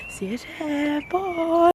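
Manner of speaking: sing-song high farewell; chirpy high sign-off; high bright goodbye. Real, not acted. Cheerful goodbye to camera.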